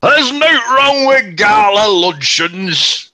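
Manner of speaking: loud, high, slow sketch-character impression; Michael Palin's dad. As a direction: belt it out slowly like a hammed-up sketch dad. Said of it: "His dad, Michael Palin".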